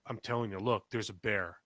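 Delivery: low, soft mock warning; hushed warning. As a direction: lightly act out a secretive alert. Teasing wife with fake bear warning.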